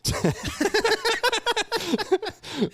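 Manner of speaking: loud high-pitched cackle; high shrieking laugh. Real, not acted. Bursts out laughing at the joke.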